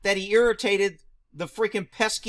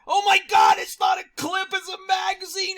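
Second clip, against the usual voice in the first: much higher shrill mocking pedant; mock word-Nazi shriek; 11 semitones higher than usual. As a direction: shriek like an outraged nitpicker. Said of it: "word Nazis out there".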